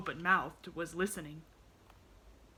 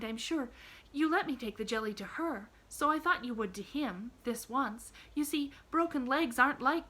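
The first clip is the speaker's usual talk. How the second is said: lightly voiced young girl, pitched higher; a bright little girl, gently arguing; a hint of an earnest young girl; Pollyanna; 6 semitones higher, 6 dB louder than usual. A narrator's reading.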